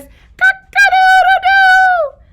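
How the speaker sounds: very high, loud, slow crow; rooster crow. Shown as a demonstration. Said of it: "And the rooster says".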